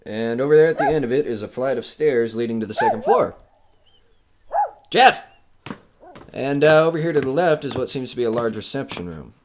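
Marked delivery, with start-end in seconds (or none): 4.9-5.2 sharp, loud, higher call of the dog's name. Acted. Owner calls his dog across the hall, loud and sharp. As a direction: yell the name to snap the dog back.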